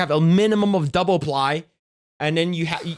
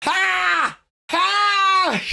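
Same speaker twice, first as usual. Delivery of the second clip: high, shouted yelps with a slower cry in the middle; startled shouting at a cold bidet blast; shocked yelps; 12 semitones higher than usual. Pretend. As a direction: yelp like an icy jet of water just hit you. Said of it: "just like".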